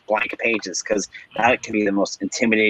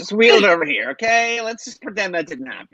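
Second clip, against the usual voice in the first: much higher, breezy brush-off; mock dismissive designer; 9 semitones higher than usual. Host imitating a designer hiding a bad design, high and breezy. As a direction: wave it away with fake cheer.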